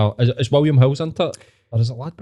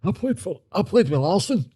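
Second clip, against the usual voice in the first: high, softer Glasgow patter; pure Glasgow accent; 7 semitones higher, 5 dB quieter than usual. Acted. Glasgow bragger name-dropping Larson, high put-on Glasgow accent. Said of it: "that Glasgow accent? See, like, that pure".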